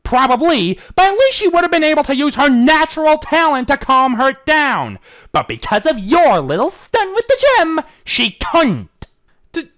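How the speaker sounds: high, loud argument; heated exchange. Acted. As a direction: press each point. Shining scolds, Trixie stammers excuses.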